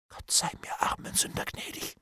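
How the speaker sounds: hushed prayer on the breath; whispered terror. A narrator's reading. Bailiff hears of the death penalty, prays in a whisper. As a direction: whisper it in mortal fear.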